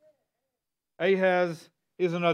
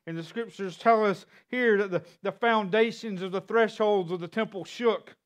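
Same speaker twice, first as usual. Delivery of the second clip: usual speaking voice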